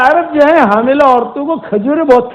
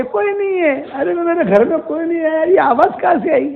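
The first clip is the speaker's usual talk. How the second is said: higher, hushed frightened voice breaking into a cry; startled and scared; 6 semitones higher, 5 dB quieter than usual. Acted. A person alone at home hearing an unseen voice, scared. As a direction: ask where the voice came from, close to tears.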